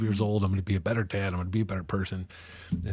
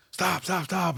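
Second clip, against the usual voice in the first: much higher, urgent mock shout; guards shouting stop; 10 semitones higher, slower than usual. Guards running up to the fight, urgently shouting stop higher. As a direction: bark the order at him to stop. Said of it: "were running up like".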